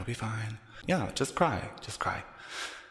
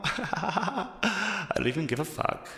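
laughing through the line, slow and higher; 6 semitones higher, 3 dB louder, slower than usual. Real, not acted. Actress laughing at herself, slow and higher, not caring. Laughing at her own fake scene.

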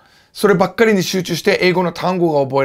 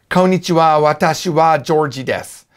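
beginner's Japanese in a heavy American accent; clumsy American-accented Japanese. Shown as a demonstration. Teacher mimics his early Japanese, bad American accent. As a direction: say it with a thick, awkward American accent. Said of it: "発音が下手なんですよ、".

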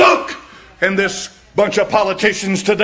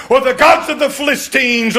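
usual speaking voice

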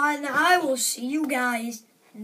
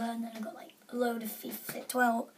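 whisper; 3 semitones lower, 9 dB quieter, faster than usual. Real, not acted. Trailing whispered list.

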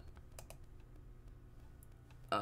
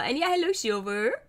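ordinary talk, nothing marked

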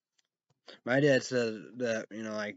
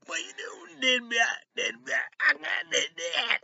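mocking voice; 5 dB louder than usual. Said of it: "they're like".

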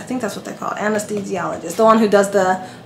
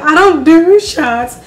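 whiny protest; 6 semitones higher, 5 dB louder, slower than usual. Retold or imitated. Her own retold protest.

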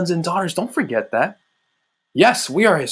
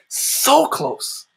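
higher, ringing repeat of so close; lifted awe; 7 semitones higher than usual. Real, not acted. Preacher marvelling at how close the Father is, higher lifted repeat. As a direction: lift it up in wonder.

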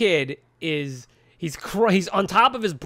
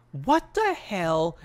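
slow disbelief; much slower than usual. Retold or imitated. Reacting to a TV scene.

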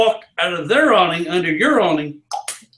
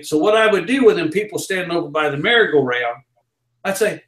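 usual speaking voice

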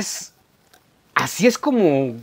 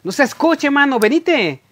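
attempted chapín accent, pitched higher; Guatemalan chapín accent; 6 semitones higher than usual. Shown as a demonstration. Vlogger showing viewers how he thinks Guatemalans talk, chapín accent. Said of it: "es el acento chapín".

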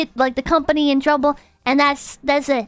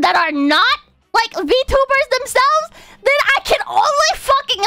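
raised, heated ranting; 8 semitones higher than usual. Real, not acted. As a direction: really let loose the rant. Ranting about number weirdos.

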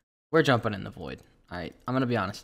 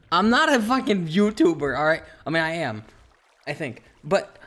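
loud, high protest; worked-up self-defence; indignant rant; 5 semitones higher, 7 dB louder than usual. Real, not acted. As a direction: push back hard, exasperated. Ranting at imagined critics.

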